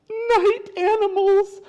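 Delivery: high, slow, loud quaver of dread; hammed-up storybook fright; fearful possum. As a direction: draw it out in a high scared critter voice. Possum names what frightens it.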